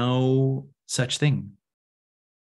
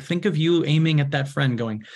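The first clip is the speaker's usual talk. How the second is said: ordinary delivery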